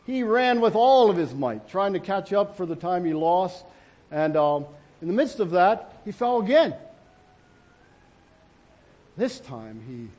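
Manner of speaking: ordinary delivery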